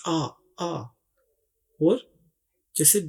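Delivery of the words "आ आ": आ held as an open aa vowel sound. Shown as a demonstration. Teacher to students, isolating the aa vowel in out. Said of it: "आवाज निकाल रहा है".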